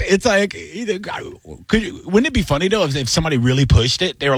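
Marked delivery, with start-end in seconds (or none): none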